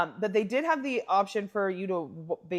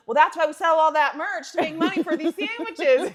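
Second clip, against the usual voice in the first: laughing mock quote; 7 semitones higher, 6 dB louder than usual. Retelling a movie character's line.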